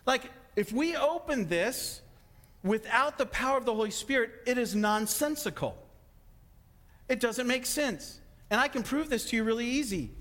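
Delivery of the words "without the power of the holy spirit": normal talking voice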